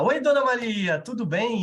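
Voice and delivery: imitating an out-of-place cheery greeting; breezy cheerful greeting. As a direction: greet her like nothing is wrong. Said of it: "Eu não posso chegar pra um paciente crítico ... e falar".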